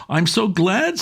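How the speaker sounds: put-on high sweet young woman's voice; bright and innocent; little woman. A narrator's reading. Wife asking the uncle about the book, high sweet voice.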